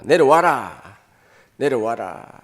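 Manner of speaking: loud jeering command, falling; shouted taunt. Retold or imitated. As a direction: bark it up at the cross. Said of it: "군중들도 소리칩니다".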